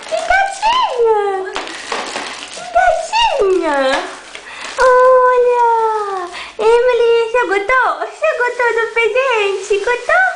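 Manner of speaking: high sing-song coo over the present. Real, not acted. Mother asking her baby if she likes it, high excited coo. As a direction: gush over it for the little one.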